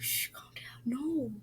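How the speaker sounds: soft whispered shushing; whispered hush to calm cousin. Retold or imitated. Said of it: "And like".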